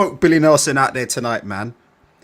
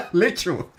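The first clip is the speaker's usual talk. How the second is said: ordinary talk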